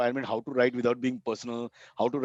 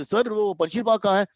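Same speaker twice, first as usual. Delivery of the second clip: eager Delhi local; 10 semitones higher, 6 dB louder, much faster than usual. Retold or imitated. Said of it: "You say".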